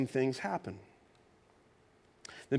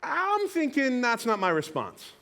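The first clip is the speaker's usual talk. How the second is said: emphatic; 9 semitones higher, 3 dB louder, slower than usual. Real, not acted. Preacher builds to emphatic point.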